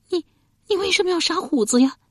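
high, distressed, stammered plea; high wailing question; tearful voice. A narrator's reading. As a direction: plead on the verge of tears. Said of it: "且带着哭腔".

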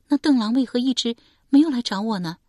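put-on young woman Shi Pei. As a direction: tell it as a wounded daughter.

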